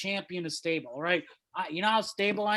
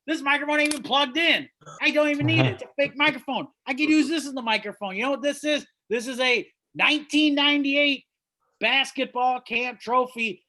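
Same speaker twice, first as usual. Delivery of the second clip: loud; 7 semitones higher, 4 dB louder than usual. Real, not acted. Comic rant, loud and high.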